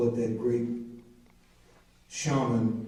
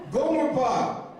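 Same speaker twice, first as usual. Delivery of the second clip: Gomer Pyle voice; 10 semitones higher, 3 dB louder than usual. Retold or imitated. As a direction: lightly play the Gomer Pyle twang, higher. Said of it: "I just thought I'd say".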